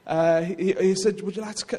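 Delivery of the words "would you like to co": softly spoken polite invitation; very quiet. Retold or imitated. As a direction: imitate a soft-spoken man. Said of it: "he's very quiet".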